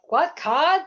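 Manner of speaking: mock Northeast Kingdom Vermont twang on "card"; Northern Vermont accent. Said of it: "the N E K accent".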